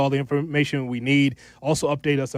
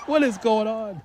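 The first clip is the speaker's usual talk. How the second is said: mom from Brooklyn; 8 semitones higher than usual. Retold or imitated. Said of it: "My mom ... would say".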